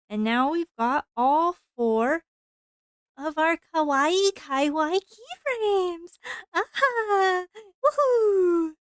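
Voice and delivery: high, loud excited cheering; excited anime fan. Real, not acted. Excited reveal of keyframes.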